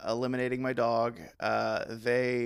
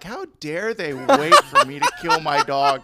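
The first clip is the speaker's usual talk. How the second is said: higher and louder, hammed-up indignant fuming; mock outrage; 8 semitones higher, 7 dB louder than usual. To cohost, retelling fuming at the vet, mock outrage. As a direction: rant like a wronged customer, half laughing. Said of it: "be like".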